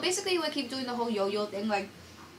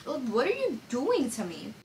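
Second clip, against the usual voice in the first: mock-exasperated plea, pitch swooping up; exasperated protest. She retells her reaction to his cancelling, exasperated. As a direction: plead in mild disbelief at being messed around.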